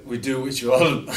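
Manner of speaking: breaking into laughter. Real, not acted. Breaks into laughter mid farewell speech.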